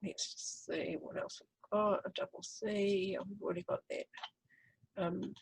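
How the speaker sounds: half-whispered aside while searching the board; soft muttered aside to self; muttering to herself. Real, not acted. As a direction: drop to a murmur, thinking aloud.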